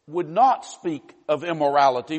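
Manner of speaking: ordinary delivery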